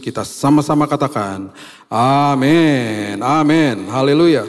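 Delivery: rousing amen to close a prayer; congregational amen. Real, not acted. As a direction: lead everyone in a long, lifted amen. Leads congregation in closing amen.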